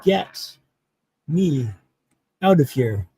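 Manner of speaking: long pause after each word; retold written plea, one word at a time.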